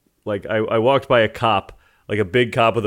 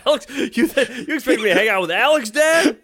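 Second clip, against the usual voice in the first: high, whiny mock yell at dad; teen son shrieking at dad that he won't hang out with Alex; mock screaming at dad; 12 semitones higher than usual. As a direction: squeal it like a teen scolding his father. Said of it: "screaming at my dad".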